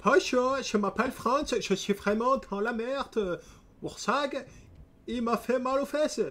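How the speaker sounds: high-pitched hammed-up Alsatian accent. Streamer voices France for chat in a hammed-up Alsatian accent. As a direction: ham up a silly Alsatian voice as France. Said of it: "l'accent alsacien".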